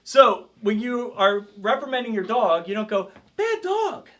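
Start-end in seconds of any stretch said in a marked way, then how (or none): 3.4-4.1 "Bad dog" squeaked up high; a pleading high "Bad dog"; high, wrong way. Shown as a demonstration. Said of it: "You don't wanna get up here high like".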